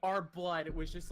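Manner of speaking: usual speaking voice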